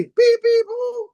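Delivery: very high, held honking noises; imitated car horns. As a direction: honk like impatient traffic. Imitates car horns in stressed streets.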